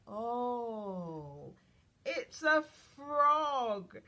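big "oh!" then slow, excited announcement; amazed discovery for a small child; delighted surprise. Acted. Reveals frog under book flap.

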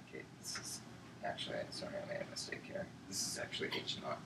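unvoiced whisper to himself. Real, not acted. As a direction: whisper the correction aside. Aside correction while at the board.

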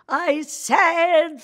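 loud, high mock-German outburst; hammed-up furious German teacher, spewing gibberish; really angry German. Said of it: "she got really angry at me".

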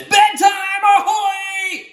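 high shouted call; octopus calling out. Acted. Octopus announcing bedtime, high ringing shout. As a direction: sing out bedtime like a ship's bell. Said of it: "So he says".

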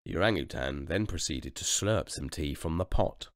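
ordinary delivery, nothing marked

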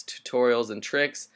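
ordinary delivery

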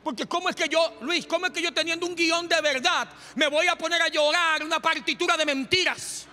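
high, loud, driving sermon; fiery pulpit delivery, shouting the kingdom message; preaching at full force. Acted. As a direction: preach it hard to the congregation.

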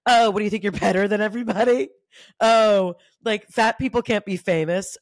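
loud, sneering mock insults; mocking troll voice. Voicing imagined trolls, loud and sneering. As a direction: sneer it like a cruel commenter.

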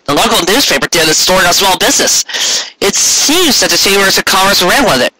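proud baker rushing through his news; eager news. Acted. Carrot shares his news.

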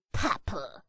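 usual speaking voice

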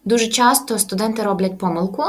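ordinary talk, nothing marked

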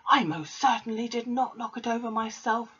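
lightly hammed-up headmistress denial; Trunchbull roaring. A narrator's reading. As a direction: bristle as the affronted headmistress. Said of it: "roared the Trunchbull".

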